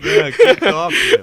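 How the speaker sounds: high, loud delighted reaction; excited. Real, not acted. Listener cheering the funny story, high and loud. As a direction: yelp out your delight.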